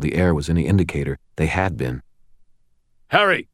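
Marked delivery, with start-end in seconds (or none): 3.1-3.5 high cry of warning; urgent cry. A narrator's reading. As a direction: cry out the name, pitched way up. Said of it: "Michael cried".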